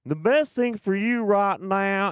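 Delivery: a lightly voiced smug drawl; smug tough guy. Voicing the hero before he tases Dolly, mock-soothing drawl. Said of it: "He's like".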